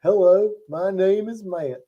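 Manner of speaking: slow, drawn-out Australian twang; southern twang. Shown as a demonstration. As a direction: stretch the vowels into southern-style Australian. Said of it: "the southern twang of British accents".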